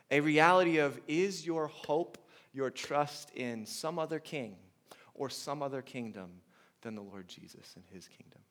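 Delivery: ordinary talk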